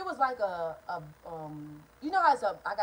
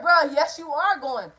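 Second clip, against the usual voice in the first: loud insisting; 3 semitones higher, 8 dB louder, slower than usual. Retold or imitated. Insisting to brother.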